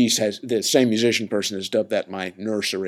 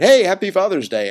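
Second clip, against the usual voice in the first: high, loud, chirpy imitation of a caller; teasing cheery greeting; 10 semitones higher, 9 dB louder than usual. Imitating musician friend calling to tease him, cheery greeting. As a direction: sing out a mock-celebratory greeting.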